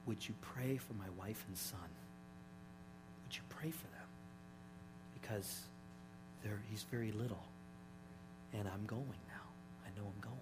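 hushed, low plea passed on from a dying father; retold dying whisper. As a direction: speak softly, as if into a nurse's ear, with pauses. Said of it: "he whispered in my ear".

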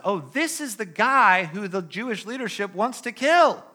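excited recognition. Acted. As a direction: it has to be him, realize it aloud. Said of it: "Now they recognize".

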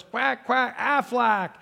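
high squawking Aflac quack; Aflac duck. Retold or imitated. The shot duck crying out, high comic Aflac quack.

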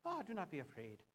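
a hint of Irish brogue; Irish accent. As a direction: lightly imitate an Irish actress playing an angel. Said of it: "she was Irish, right?".

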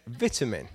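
VITAMIN with a short i, as VIT-a-min; quiet, low British "VIT-amin". Shown as a demonstration. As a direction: say vitamin with first syllable like "bit". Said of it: "I would say".

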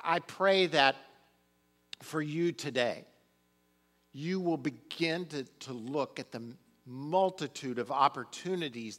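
loud, rising preacher's exhortation; fervent preaching. Acted. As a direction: lift the congregation, full voice. Preaching at full force.

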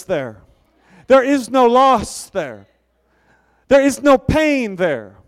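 loud, slow, ringing preacher voice; building sermon climax; preaching at full force. Acted. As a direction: thunder each line from the pulpit.

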